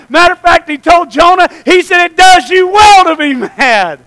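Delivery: loud, high, forceful preaching. Real, not acted. Preacher challenges listeners who disliked hearing mad and sad, forceful preaching. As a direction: confront the congregation at full volume.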